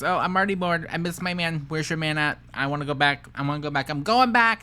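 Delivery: hammed-up impatient whining, peaking at the end; mocking imitation. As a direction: mimic her fickle complaints, building to a loud finish. Imagines her changing her mind.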